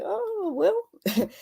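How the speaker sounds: high, light "oh well"; breezy resignation. Acted. Still curious but not worried, breezy resignation. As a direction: let it go in a high little voice. Acting out her easygoing acceptance now.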